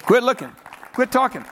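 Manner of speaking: normal talking voice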